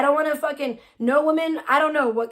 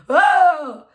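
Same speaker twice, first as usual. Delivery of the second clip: mock alarm; 5 semitones higher, 8 dB louder than usual. Imitates someone reacting in the scene.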